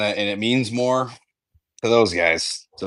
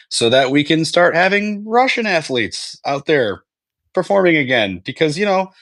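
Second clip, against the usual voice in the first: mock generous; 7 semitones higher than usual. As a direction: grandly offer to take them back, climbing higher. Mock magnanimous high voice.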